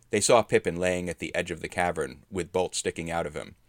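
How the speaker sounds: ordinary delivery, nothing marked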